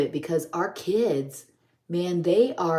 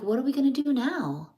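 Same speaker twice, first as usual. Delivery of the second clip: repeated much higher with stress on gonna; higher, insistent repeat; 8 semitones higher than usual. Retold or imitated. Husband repeating his question to puzzled wife, high insistent repeat. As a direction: press the question again, pitched up. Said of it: "He's like".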